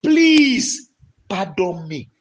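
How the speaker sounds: fervent prayer said for them to copy; model prayer. Shown as a demonstration. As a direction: show them how to beg the Father. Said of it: "I want you to go before your father".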